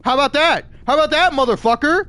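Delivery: angry yell at puritans over censorship; furious rant. Real, not acted. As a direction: yell it back at them, building to a scream. Ranting back at imagined puritans.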